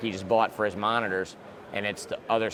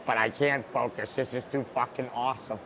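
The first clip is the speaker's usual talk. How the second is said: normal talking voice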